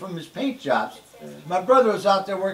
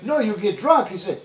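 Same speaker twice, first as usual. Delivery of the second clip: normal talking voice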